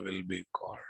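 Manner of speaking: soft unvoiced whisper. Shown as a demonstration. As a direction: breathe the line out as a whisper. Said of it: "repeats it whispered".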